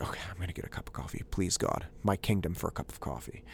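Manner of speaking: low, soft muttered plea; at his end. Retold or imitated. Frazzled dad praying for coffee, at his end. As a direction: beg under your breath, worn out. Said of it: "I'm at my end".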